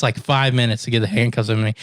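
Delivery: usual speaking voice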